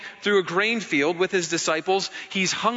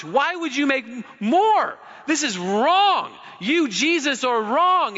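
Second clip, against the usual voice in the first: indignant scolding; 5 semitones higher than usual. Retold or imitated. Religious people scolding Jesus over the wine, outraged. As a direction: protest like a scandalized churchgoer.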